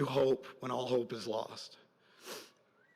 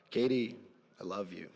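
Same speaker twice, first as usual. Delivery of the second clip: tender; 5 semitones lower, 4 dB quieter than usual. Real, not acted. Father addressing daughter Katie.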